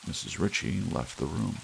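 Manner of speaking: ordinary delivery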